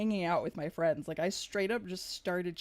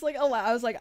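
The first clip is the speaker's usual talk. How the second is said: normal talking voice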